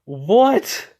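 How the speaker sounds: put-on a high, stunned "What?" from the boys; shocked what. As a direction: throw "What?" up high in disbelief. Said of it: "all the guys saying".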